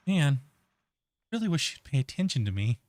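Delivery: lightly put-on inner voice; inner monologue voice. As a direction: think it aloud like a lonely inner voice. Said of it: "You mind my inner lo-, monologue voice?".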